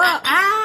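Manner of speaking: sharp high yelp of pain; high startled cry from a burn. Acted. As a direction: yelp as hot coffee hits you. Hot coffee spilled on him.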